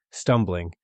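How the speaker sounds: ordinary delivery, nothing marked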